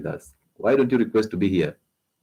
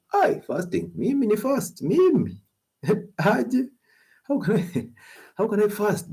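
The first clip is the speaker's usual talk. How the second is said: high, squeaky mocking voice with laughs; mock whiny excuse; 14 semitones higher, slower than usual. Doctor mocking listeners who fear fasting, high whiny voice. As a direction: mimic a reluctant faster, pitched way up. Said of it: "And you're like".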